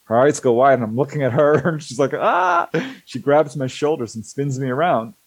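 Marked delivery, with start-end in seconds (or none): 2.1-2.7 sudden high shriek; retold scream. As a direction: shriek it out in fright. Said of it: "she screams".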